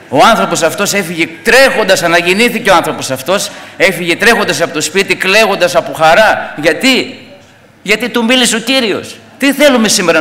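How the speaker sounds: rousing sermon delivery; fervent preaching. Acted. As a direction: preach it out to the whole church with fire. Preaching to the congregation.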